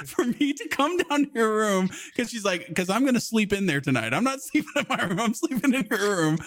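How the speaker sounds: lightly voiced little girl; imitating daughter. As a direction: voice the scheming toddler. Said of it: "she instantly just goes like".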